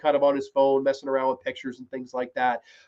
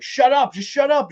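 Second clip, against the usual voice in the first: retold yell; 9 semitones higher, 3 dB louder than usual. Widow yelling at lady.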